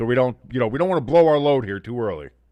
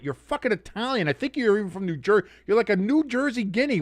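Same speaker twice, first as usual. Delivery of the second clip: ordinary delivery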